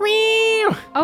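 very high squeaky meowing; cat meow. Acted. Trapped cat Papa meows from the web.

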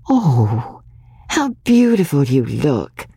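put-on a touch of girlish wonder; childlike admiration. A narrator's reading. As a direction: marvel like a child clasping her hands. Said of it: "said Magnolia again, and clasped her hands like a child".

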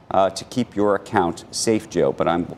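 ordinary delivery

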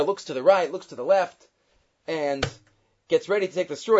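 ordinary delivery

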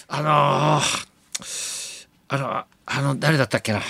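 acting out a memory blank, drawn-out fillers; groping for a name. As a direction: stall and search for the word. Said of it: "急にさ、出てこなかったんですよ". (思い出せずに言葉を探す真似)

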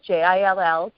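J I L L, each letter separate and clear; spelled out. Shown as a demonstration. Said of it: "uh, Jill".

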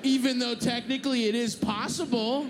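loud, high-pitched rhetorical outrage; indignant mock protest. Passenger lecturing airline trainee, loudly indignant.

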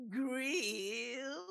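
high rising cry on grill; a goofy troll calling grill. Shown as a demonstration. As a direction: stretch grill like a bridge troll. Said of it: "sounds like someone who lives under a bridge".

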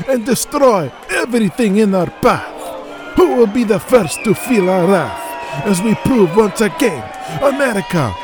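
villain promo shout. Acted. Prince Abdullah shouts his promo against America. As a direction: roar the threats at the crowd.

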